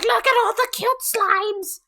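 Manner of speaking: high and excited about the cute slimes; excited squeal. Retold or imitated. Imagining playing Slime Rancher, high excited gushing. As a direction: gush like a thrilled kid. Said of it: "I'm just like".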